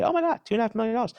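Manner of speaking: fast, high mock exclamation; hammed-up dismissive quote; mocking naive comment. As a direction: rattle it off like a clueless onlooker. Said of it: "when someone looks at me".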